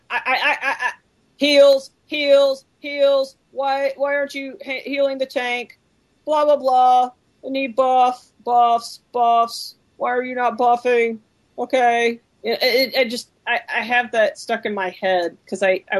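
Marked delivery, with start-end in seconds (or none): 0.1-7.1 high, nagging gamer complaint; whiny raid gamer. Retold or imitated. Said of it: "lived in a house full of WoW players". 7.4-11.2 high, nagging demand for buffs; whiny raid gamer. Retold or imitated. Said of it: "lived in a house full of WoW players".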